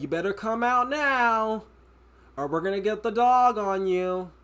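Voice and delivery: a cop at the door warning an intruder, mock-stern and slow; unserious cop. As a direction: play a cop bluffing about a dog, slow and loud. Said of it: "it's just the way he was saying it".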